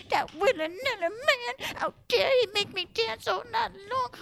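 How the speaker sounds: put-on old woman grumbling about the man; fuming old woman. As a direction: whine it like a crotchety old lady.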